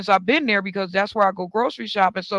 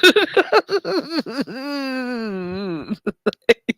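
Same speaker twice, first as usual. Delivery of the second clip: a high, breaking laugh at herself; crying laugh; 5 semitones higher than usual. Real, not acted. Laughing at her own tacky comeback, crying laugh. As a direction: laugh till it almost cracks into a cry.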